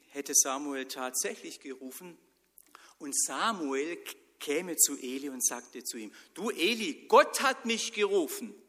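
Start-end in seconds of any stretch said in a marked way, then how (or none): 6.3-8.5 high and loud, bursting with news; excited boy. Acted. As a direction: blurt it out to Eli. Samuel excitedly tells Eli. (Samuel ruft Eli aufgeregt zu, laut und hoch)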